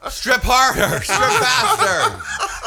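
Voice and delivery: high, slow laughing orders; laughing commands. Acted. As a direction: bark orders while laughing. Host chants orders laughing.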